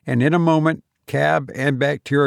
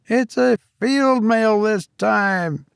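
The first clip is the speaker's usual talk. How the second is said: high, eager London stable lad; cockney boy; 10 semitones higher, slower than usual. A narrator's reading. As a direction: chirp it like a young ostler. Ostler boy.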